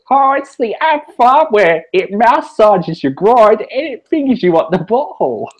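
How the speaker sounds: high, slow comic salesman voice; mock infomercial pitch. Shown as a demonstration. Host parodying a product advert for cohost, high hammed-up voice. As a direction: pitch it way up and stretch the sales patter.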